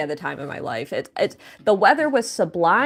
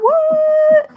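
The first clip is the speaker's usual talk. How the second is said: surprised; 8 dB louder than usual. Real, not acted. Reacting to a donation alert.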